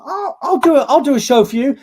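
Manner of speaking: high, loud eager volunteering that fades down at the end; eager offer. Retold or imitated. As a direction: jump in to save the theatre, then settle. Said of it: "I was like".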